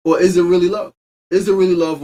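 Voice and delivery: high, loud pointed question; rhetorical challenge. Real, not acted. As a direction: press the audience hard on love. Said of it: "rhetorical challenge".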